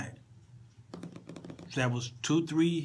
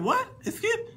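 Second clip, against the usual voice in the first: very high-pitched mock shock; acted disbelief. Songwriter answering the guitar player, acted disbelief. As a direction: squeak out an incredulous reaction. Acts own startled reply to guitarist.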